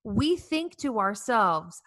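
ordinary talk

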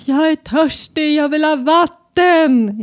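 put-on high, slow whiny child voice; high child's whine for water; imitating child whining. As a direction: mimic a demanding little kid, drawn out. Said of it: "skriker Nour".

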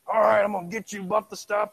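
high, loud mock wrestler taunt; wrestler trash talk. Preacher imitates wrestler taunting opponent, loud mock bravado. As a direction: puff up like a ring showman. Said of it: "the wrestlers have to be angry".

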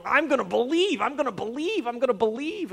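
strained, high-pitched chant of mock resolve; forced determination. Preacher mimicking trying harder to believe, forced high determination. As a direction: psych yourself up, pitched high and insistent.

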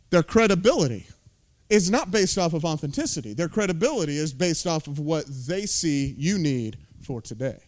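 ordinary talk

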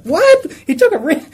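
high, loud startled "What?"; shocked disbelief. Pretend. Adam hearing the news, in loud shocked disbelief. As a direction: jump up in pitch as if just told. Imagined Adam learning of rib.

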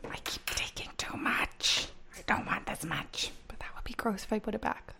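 unvoiced whisper aside. Real, not acted. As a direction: whisper it to yourself. Whispered aside while spooning.

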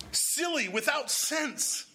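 high, emphatic rebuke; rebuking. Acted. Preacher voicing God rebuking his silly people, high and emphatic. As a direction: rise up on the words as if scolding.

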